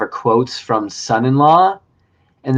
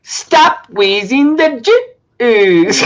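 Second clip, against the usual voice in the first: very slow, high, laughing drawl; Pauly Shore cadence; 9 semitones higher, much slower than usual. Acted. Host doing a quote for the guest, high slow laughing drawl. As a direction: stretch it out, laughing. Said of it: "That's not my impression, but that's the cadence".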